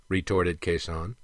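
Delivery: ordinary delivery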